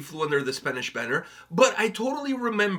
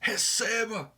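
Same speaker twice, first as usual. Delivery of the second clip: higher-pitched mock explorer exclamation; explorer's triumphant cry; 5 semitones higher, slower than usual. Explorer reaching Brazil announces himself, mock triumphant cry. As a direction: proclaim it like an arriving discoverer. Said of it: "So when he got there, he's like".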